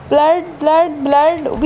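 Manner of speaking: high, alarmed repeats of the word; jumpy gamer yelping at blood; startled cry of blood. Real, not acted. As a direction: recoil at the sight of blood. Spots blood in horror game.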